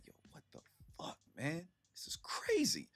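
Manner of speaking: stunned, breathless whisper; shocked whisper. Retold or imitated. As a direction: whisper it like you can't believe it. Shocked at coworker's birth year.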